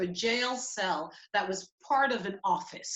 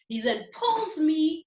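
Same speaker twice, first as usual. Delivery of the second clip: ordinary talk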